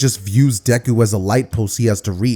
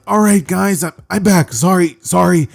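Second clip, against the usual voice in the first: higher, sheepish voice; apologetic Light Deku; 7 semitones higher than usual. Acted. Deku back as Light, holding his nose, apologetic. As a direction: apologise in a pitched-up voice. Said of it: "he would have to hold his nose".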